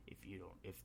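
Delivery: low, soft remembered voice; Tony. Retold or imitated. Recalling the mentor's words in the hero's head, hushed and low. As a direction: quietly intone a mentor's reproof. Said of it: "he hears Tony's voice".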